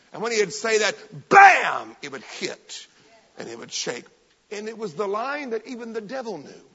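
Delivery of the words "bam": very loud "bam"; impact shout. Retold or imitated. Preacher showing the line hit, explosive shout. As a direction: slam the word like a blow.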